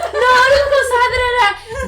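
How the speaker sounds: high, loud mock scolding; guilt-tripping relative. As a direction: whine it like a guilt-tripping aunt.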